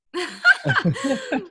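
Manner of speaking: bright laugh that jumps up high; host cracking up at the idea; high burst of laughter. Real, not acted.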